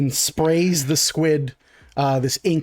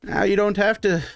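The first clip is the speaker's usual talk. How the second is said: higher, bright merchant handing back the book; pleased with his work, hand it over; cheerful shopkeeper; 6 semitones higher than usual. Acted. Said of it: "He goes".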